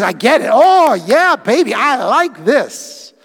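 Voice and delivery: high, loud, thrilled whoa; delighted Adam. Acted. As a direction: sell the man's wide-eyed delight, loud and high. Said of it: "He goes".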